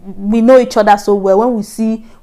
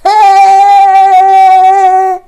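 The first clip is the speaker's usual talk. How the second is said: high, loud, held "hey"; sustained cry of mock panic; long wail; 10 semitones higher, 10 dB louder than usual. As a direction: wail out in dramatic despair. Wailing that nobody will save her.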